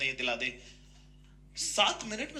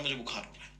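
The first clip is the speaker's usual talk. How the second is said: low, soft mock-sick mumble; feigned illness; 7 semitones lower, 9 dB quieter, faster than usual. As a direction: plead a fever feebly, badly faked. Father feigns fever to avoid mother. (बीमारी का बहाना, दबी धीमी आवाज़)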